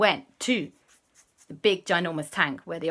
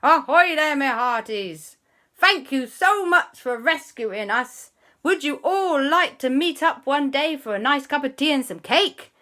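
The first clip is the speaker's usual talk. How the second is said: much higher, louder hearty captain voice; jolly sea captain; 8 semitones higher, 5 dB louder than usual. Acted. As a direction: boom a cheerful ahoy. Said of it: "Captain Barnacles called them up".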